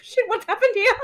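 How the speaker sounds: half-laughing, half-alarmed outburst; laughing shock. Retold or imitated. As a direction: blurt it out through a laugh. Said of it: "I was like".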